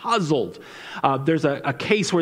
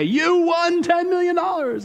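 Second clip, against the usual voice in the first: much higher, slow, showy prize announcement; TV announcer; 13 semitones higher than usual. Retold or imitated. Spokesperson at the door announcing the prize, in a high showy voice. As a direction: sing it out like a game show host. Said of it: "the spokesperson would say".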